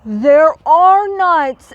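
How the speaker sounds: loud, slow excited call; excited exclaim. Acted. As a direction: call out eagerly about nuts. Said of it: "exclaimed Bruce".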